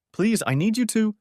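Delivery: wife begging her husband to stay; desperate plea. Acted. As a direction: beg him not to shut you out.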